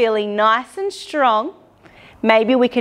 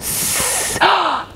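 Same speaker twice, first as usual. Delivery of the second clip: surprised gasp; 4 semitones higher than usual. Pretend. Discovers a cobra.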